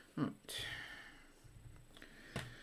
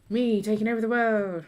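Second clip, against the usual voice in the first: sneering panto villain; 6 semitones higher, 9 dB louder than usual. Acted. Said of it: "That was quite an evil laugh".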